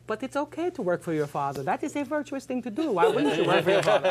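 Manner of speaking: earnest German objection in a badly faked accent; botched German accent. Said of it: "I don't know what accent I'm doing".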